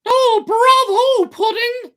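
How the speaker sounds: shrill, very high, slow mocking cry; a tiny hidden voice jeering loudly; mocking little voice. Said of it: "exclaimed the same little voice".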